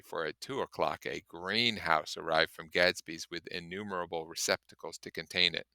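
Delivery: plain reading voice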